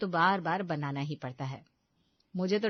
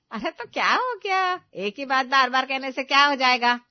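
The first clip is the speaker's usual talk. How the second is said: scolding outburst; 5 semitones higher, 9 dB louder than usual. Acted. Mother-in-law scolds daughter-in-law over the tea, loud and cross. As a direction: snap at them like an annoyed mother-in-law.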